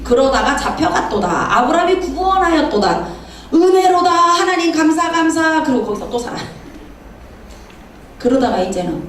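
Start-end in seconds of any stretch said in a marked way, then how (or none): 0.0-5.6 hammed-up pious declaiming, rising; mock recitation. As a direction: intone it like exaggerated thanksgiving. Mock recital of Lot's story, rising.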